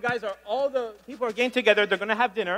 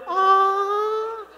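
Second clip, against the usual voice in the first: high, soft, awed "ah" sighed out; 10 semitones higher than usual. Retold or imitated. Comedian imitates the sisters' admiring ah. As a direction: coo a long admiring ah. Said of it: "The sisters were like".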